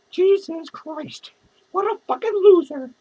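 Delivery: normal talking voice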